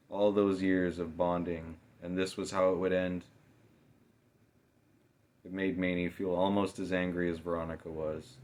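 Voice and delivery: ordinary delivery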